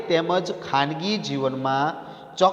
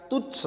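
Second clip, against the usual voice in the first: answering his own question with a sharp high તુચ્છ; emphatic answer; 9 semitones higher, 6 dB quieter than usual. Shown as a demonstration.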